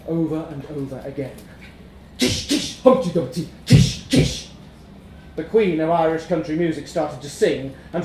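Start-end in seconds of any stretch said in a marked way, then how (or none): 2.2-4.5 hissed kish, chanted Humpty Dumpty; band sound imitation. A narrator's reading. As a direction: mimic the drums and bass.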